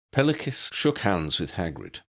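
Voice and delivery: usual speaking voice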